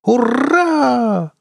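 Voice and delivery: very high, drawn-out cheer; Ксифая's squeal. A narrator's reading. Crewmate cheers captain's promise, squealing. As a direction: squeal with delight. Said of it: "взвизгнула Ксифая".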